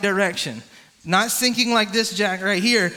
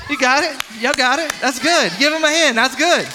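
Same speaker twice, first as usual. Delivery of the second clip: excited cheering; 4 semitones higher, 4 dB louder than usual. Real, not acted. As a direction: cheer him on and get the room to clap. Cheering the swimming volunteer.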